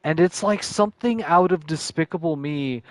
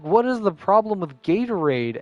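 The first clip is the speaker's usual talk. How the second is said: exasperated; 7 semitones higher, 4 dB louder than usual. Retold or imitated.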